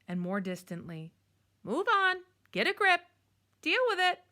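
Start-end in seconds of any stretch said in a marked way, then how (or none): 1.6-4.2 much higher, loud owl cries; shrieking owl. Acted. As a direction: call it out from a distance. Owl calling from afar.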